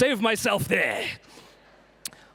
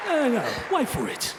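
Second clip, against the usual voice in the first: put-on Jason Statham British accent; 3 semitones higher than usual. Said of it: "British accent, but as Jason Statham".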